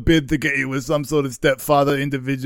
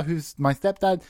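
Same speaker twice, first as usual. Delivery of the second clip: ordinary talk, nothing marked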